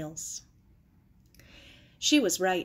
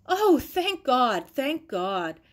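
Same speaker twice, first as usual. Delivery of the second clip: tearful relief; 4 semitones higher, 6 dB louder, slower than usual. A narrator's reading. Aunt hears they're safe.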